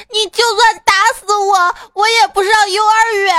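put-on high, loud wailing little boy; mock crying. Small boy defies mum smacking him, mock crying. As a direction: bawl it out in hammed-up sobs. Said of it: "小男孩哭着说".

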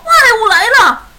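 high, very loud terrified shout; panicked yell. A narrator's reading. Dad sees a shape in the lightning, terrified yell. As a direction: scream the alarm in panic. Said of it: "熊爸爸大叫一声".

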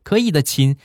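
put-on polite shopkeeper voice, high; online shop seller. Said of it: "这个商家就说".

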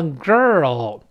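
put-on "girl" slow, with curled r and dark oh-like l; American R-coloured girl. Shown as a demonstration. Teacher modelling American pronunciation, r-coloured "girl". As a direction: curl the tongue in "girl", ending on a dark l. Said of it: "这个美式发音不好读".

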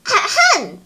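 mock throat-clear before a lecture. A narrator's reading. Little Yiyi about to start her lesson, clearing her throat. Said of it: "小依依清了清嗓子".

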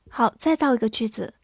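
ordinary talk

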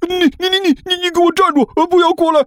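very high stammering yell; panicked roar. Acted. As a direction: squeal at him to keep back. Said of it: "对着甘球大吼了一声". (惊慌地结巴大吼)

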